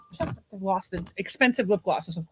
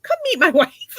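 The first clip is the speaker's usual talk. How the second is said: imitated old Cuban man, high and loud; hammed-up excited old-timer; 5 semitones higher, 6 dB louder than usual. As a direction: eagerly invite them in, voice pitched up. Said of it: "he was so excited to see him".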